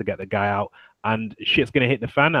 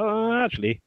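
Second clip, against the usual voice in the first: pleasantly surprised; 12 semitones higher than usual. Retold or imitated. Sceptic retelling his reaction to the trailer, pleasantly surprised. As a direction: let the pitch soar as you reconsider.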